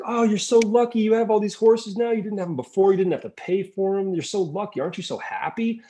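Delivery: high, eager congratulating voice; excited villagers. Retold or imitated. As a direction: gush over his luck like nosy neighbours. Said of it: "everyone in the village is like".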